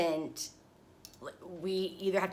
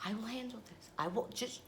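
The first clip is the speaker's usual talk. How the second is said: much softer, steady and composed; calm and reserved; 3 semitones higher, 13 dB quieter than usual. Retold or imitated. Husband calmly reassuring her at the airport, soft and composed. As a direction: play it cool and quiet, totally in control. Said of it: "And he's just like".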